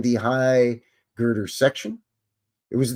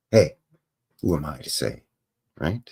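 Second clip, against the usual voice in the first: ordinary delivery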